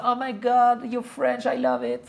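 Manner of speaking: high, loud, excited American gush; imitating gushing Americans. Americans reacting to his French accent, mock high excited gushing. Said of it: "les gens, c'est".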